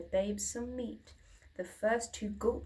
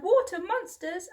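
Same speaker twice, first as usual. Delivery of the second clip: high, loud scared shriek; frightened cry; 9 semitones higher, 8 dB louder than usual. A narrator's reading. Sister shrieking at monsters in the water. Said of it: "one cried".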